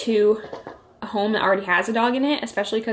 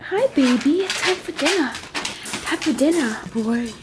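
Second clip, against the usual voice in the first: talking to a dog; 3 semitones higher than usual. Real, not acted. Feeding the dog, baby talk.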